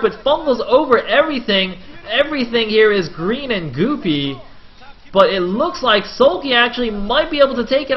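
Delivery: loud, high, rushing play-by-play of the big fight; excited play-by-play. Acted. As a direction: call the battle at full hype. Casting the big engagement.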